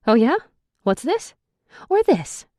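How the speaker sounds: put-on girl fed up, waving lingerie; frustrated. A narrator's reading. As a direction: throw each item at him, exasperated. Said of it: "I ask in frustration".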